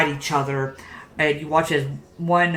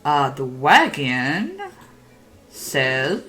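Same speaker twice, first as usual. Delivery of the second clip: ordinary talk, nothing marked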